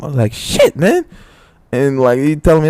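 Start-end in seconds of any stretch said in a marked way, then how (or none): 0.3-1.1 retold shock. Retold reaction to the news.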